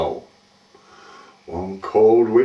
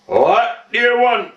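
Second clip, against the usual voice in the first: high, sharp demand from the landlady; narrator's annoyed old girl; irritated wife; 9 semitones higher than usual. A narrator's reading. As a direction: demand it crossly in a raised pitch. Wife reopens window, annoyed by pebbles.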